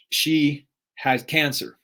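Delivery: slowed, weighted repeat; solemn slow repeat; slow heavy repeat. Real, not acted. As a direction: let it sink in slowly.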